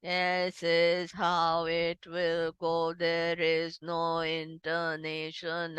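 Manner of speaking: low, slow robotic monotone; monotonous AI voice. Shown as a demonstration. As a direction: drone on one note like a machine, syllables stretched. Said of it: "it's very monotonous. So how does it go?".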